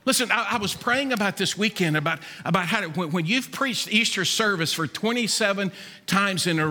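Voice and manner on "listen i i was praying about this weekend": ordinary talk, nothing marked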